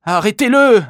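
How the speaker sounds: high, loud gasped alarm; breathless shout. A narrator's reading. Victim yelling to passers-by, breathless shout. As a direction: cry out for help while panting. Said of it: "haleta l'un des deux".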